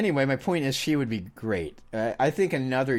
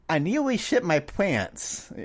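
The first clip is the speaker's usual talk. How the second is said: put-on high, slow toddler voice, words sounded out carefully; imitating a toddler; 7 semitones higher, slower than usual. Dad imitates his daughter's halting toddler speech, high and slow. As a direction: lightly play a three-year-old struggling with speech. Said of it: "it would be more like".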